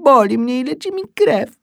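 głośna, bardzo wysoka dziecięca skarga; płaczliwy krzyk. A narrator's reading. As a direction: wykrzycz żal jak płaczące dziecko. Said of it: "krzyknąłem". (po polsku: krzykliwy lament dziecka)

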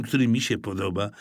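ordinary delivery, nothing marked

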